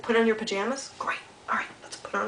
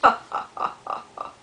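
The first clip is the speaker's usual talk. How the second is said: breathy mock hyena cackle; hammed-up hyena laugh; 3 dB louder than usual. Shown as a demonstration. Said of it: "He kinda laughs like this".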